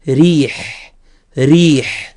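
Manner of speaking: "ريح" with a light, thin R; tarqiq ra. Shown as a demonstration. Teacher recalling the sound of tarqiq, light demonstration. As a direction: draw out "ريح", keeping the R slim. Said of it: "نتذكر صوت الترقيق".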